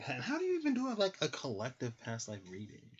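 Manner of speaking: very high, incredulous question; high, astonished "how do you even do it". Real, not acted. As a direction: voice shoots up in disbelief. Puzzled question.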